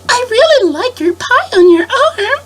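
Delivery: high slow sugary compliment. Retold or imitated.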